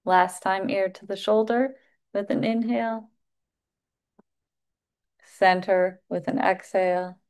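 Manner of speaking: unhurried yoga instructor cues with long pauses between; guided breathwork cueing. Acted. Yoga teacher guiding the class through neck stretches, guided breathwork cueing. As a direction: pace each cue to the breath.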